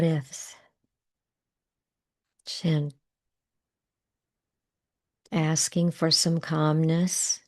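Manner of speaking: guided tapping script. Acted. Coach guiding viewers through tapping points, calm and unhurried. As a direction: lead the listener through tapping points gently.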